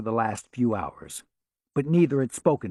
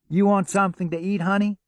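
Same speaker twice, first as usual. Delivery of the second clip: sweet diner waitress from the South calling her honey; a hint of Southern hospitality in the accent; 8 semitones higher, 3 dB louder, faster than usual. A narrator's reading. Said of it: "with an accent warm with Southern hospitality".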